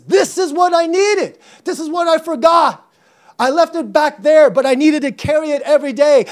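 high, loud, urgent outpouring; impassioned David. Acted. Preacher voicing David realizing what he forgot, impassioned and loud. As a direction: cry it out in fervent realization. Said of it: "That's why David says".

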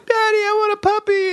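put-on very high pleading child; whiny kid. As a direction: beg like a small kid. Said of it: "kids who were like".

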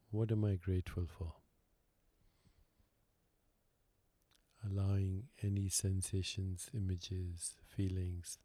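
calm, unhurried meditation guide with long pauses; guided meditation. Real, not acted. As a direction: lead them gently inward, leaving silence between phrases.

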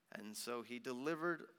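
plain reading voice

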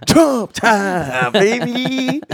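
high, drawn-out hype call; hype man. Pretend. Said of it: "he's, like, the hype man".

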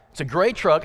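normal talking voice

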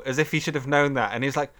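ordinary talk, nothing marked

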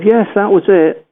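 high-pitched imitation of mum; mother's voice.

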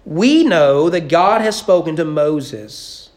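slow, self-important pronouncement; pompous religious know-it-all; mock spiritually deep. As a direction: show off your piety, drawing it out. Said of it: "supposed to sound really spiritually deep".

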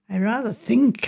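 mildly hesitant admission; reluctant. A narrator's reading. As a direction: say it with some reluctance. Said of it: "I said reluctantly".

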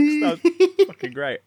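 high, slow laugh through the words; delighted, still laughing; laughing praise. Real, not acted.

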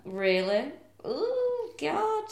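whispered really, then very high ooh God; breathy, then a high squeal; mock thrilled reaction. Friend voices the girlfriend thrilled by the question, mock.

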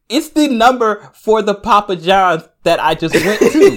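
high, loud and slowed, laughing through it; incredulous laughing punchline. Real, not acted. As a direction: land the punchline loudly through real laughter. Punchline of his pizza story.

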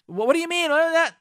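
husband bristling at wife's complaint, surprised; defensive surprise. Acted. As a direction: jump in, caught off guard. Husband's defensive reply acted.

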